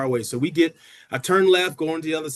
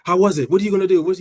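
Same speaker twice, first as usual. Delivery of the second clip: eager, louder burst of questions; excited little sibling; 3 semitones higher, 7 dB louder than usual. Retold or imitated. Younger sibling quizzing sister after her first practice, excited. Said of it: "I'm just excited".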